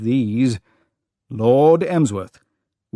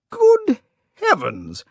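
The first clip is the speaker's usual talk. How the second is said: much higher, startled exclamation; surprised cry. A narrator's reading. Old lord finds a crying girl, startled high cry. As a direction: gasp it out as the shock hits. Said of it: "he cried".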